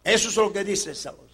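normal talking voice

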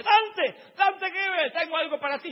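high, urgent shout across a crowd; loud eager hailing; calling out. Acted. Brother calls him through crowd.

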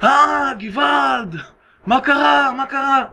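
high-pitched "gevald" outcry; storyteller's cry of alarm. Acted. Horse owner crying for help in the street, alarmed shouting. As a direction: raise a hue and cry. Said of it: "הוא מתחיל לצעוק".

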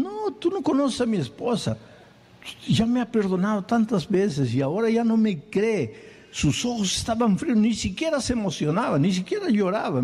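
high, strained despairing voice; distressed. Acted. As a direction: pour out the hopelessness. Despairing atheist talks about wife.

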